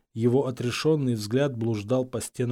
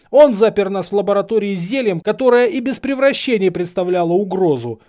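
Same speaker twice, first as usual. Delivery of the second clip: raised, loud indignant outburst; furious tirade; 8 semitones higher, 8 dB louder than usual. A narrator's reading. Harry accusing his professor, loud and outraged. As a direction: let the accusations pour out in outrage.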